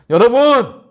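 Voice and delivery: high, loud appeal; calling out. Real, not acted. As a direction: call the congregation. Preacher calling out.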